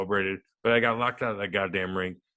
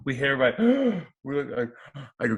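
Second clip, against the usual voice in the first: usual speaking voice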